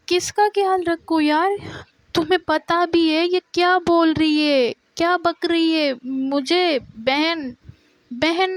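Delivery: angry outburst. Retold or imitated. Hanna confronting Ira in front of everyone, angry and high.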